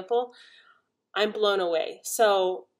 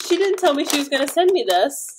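higher, louder, thrilled; excited; 6 semitones higher, 7 dB louder than usual. Real, not acted. Telling viewers about the gift, loud and thrilled. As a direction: gush about the surprise.

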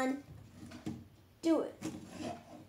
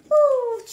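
sudden loud, much higher ooh of relief; 7 semitones higher, 8 dB louder than usual. Pretend. Overheated pretend robot cools down, high loud ooh.

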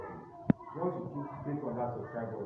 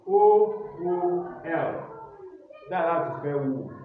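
loud, slow classroom dictation voice; spelling dictation; 10 semitones higher, 10 dB louder, slower than usual. Acted. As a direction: call each word and letter out to the class. Teacher dictating spelling words.

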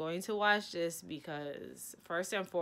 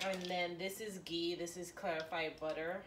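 usual speaking voice

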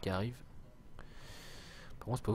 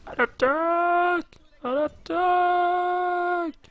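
long, very high drawn-out charge yell; hammed-up shout to attack; battle cry; 12 dB louder, much slower than usual. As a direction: scream the charge like a general.

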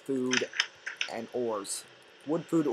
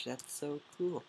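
usual speaking voice

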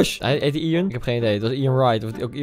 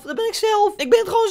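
a much higher squeaky repeat of "Dat ben ik zelf"; high mock voice. Gamer teasing himself with a high silly voice. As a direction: jump way up in pitch for the repeat. Joking about himself on camera.

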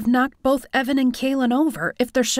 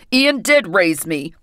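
bitter resentment; 3 semitones lower, 6 dB louder than usual. Acted. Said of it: "bitter resentment in his tone".

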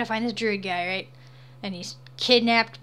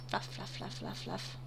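very soft, voiceless little puffs; hushed, rhythmic self-talk; whispered repetition; 13 dB quieter than usual. Real, not acted. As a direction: whisper it under your breath. Murmuring sound words while painting.

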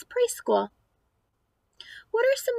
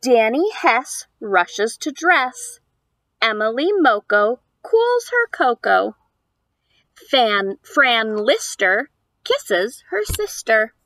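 lively, louder picture-book reading; reading to children; 6 dB louder than usual. Acted. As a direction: read the rhymes aloud to a small child. Reads picture book aloud.

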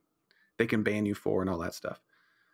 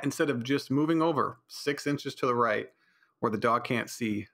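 usual speaking voice

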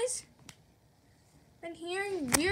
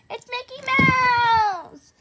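slow, loud announcement; announcing Mickey; 4 semitones higher, 7 dB louder, slower than usual. Acted. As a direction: draw out the name proudly.

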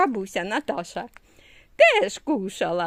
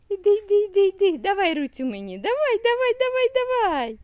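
eager coaxing; 8 semitones higher, 4 dB louder, faster than usual. Real, not acted. Mother urging her toddler to come, high and loud.

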